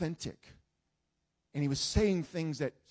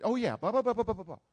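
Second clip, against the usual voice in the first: mocking singsong blah blah, louder; sarcastic; 5 semitones higher, 7 dB louder, faster than usual. The angry young man mocking his Bible, sarcastic singsong. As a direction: brush it off with sneering sarcasm. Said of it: "and just very sarcastically".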